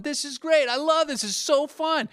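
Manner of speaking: put-on high, loud, gleeful little-girl squeal; excited child. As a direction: imitate a thrilled kid mid-ride. Daughter's delight early in bike ride.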